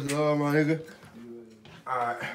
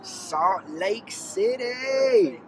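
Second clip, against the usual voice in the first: excited; 7 semitones higher, 4 dB louder, much slower than usual. Real, not acted. Surprised joke about Utah.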